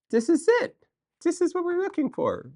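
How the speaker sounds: high, thrilled discovery; excited find. Real, not acted. Shopper spotting the torsion spring she needs, thrilled. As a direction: squeal it out, you found the exact part.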